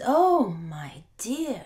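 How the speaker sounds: slow, loud, swooning oh my dear; adoring wife. Acted. Wife swooning over her husband's trade, slow and loud. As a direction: draw it out with fond delight.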